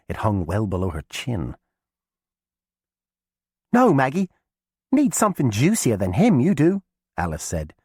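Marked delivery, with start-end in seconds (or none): 3.7-6.8 put-on high, loud girl's call; young girl voice. A narrator's reading. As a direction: call out eagerly to old cousin. Alice persuades the witch.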